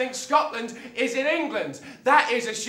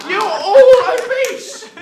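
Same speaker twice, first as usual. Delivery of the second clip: high, very loud, drawn-out outburst; shouted accusation; 9 semitones higher, 11 dB louder, slower than usual. Acted. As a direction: hurl it at the opponent, slowly and loudly. Heated insult in staged quarrel.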